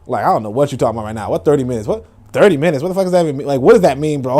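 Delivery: ordinary talk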